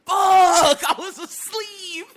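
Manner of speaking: loud high groan at waking, then a laugh; groggy exclamation. Shown as a demonstration. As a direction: curse at being woken, not at her. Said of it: "No, it was like".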